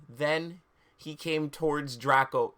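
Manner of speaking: ordinary delivery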